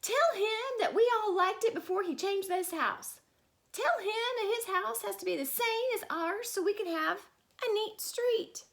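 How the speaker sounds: high, loud whiny demand; fussy neighbours. A narrator's reading. The neighbours tell the man next door what to say. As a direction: insist high and shrill like busybodies. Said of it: "They asked the man".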